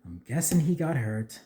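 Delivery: ordinary talk, nothing marked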